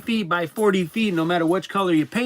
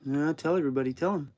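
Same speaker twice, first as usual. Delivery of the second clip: soft teasing coo; 7 dB quieter than usual. Real, not acted. Owner softly coaxing the whining dog. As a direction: hush and tease the whining dog.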